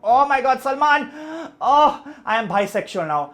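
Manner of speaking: loud, high fangirl squeal; over-the-top cinema squeal; fan screaming. Retold or imitated. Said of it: "I am sitting there going".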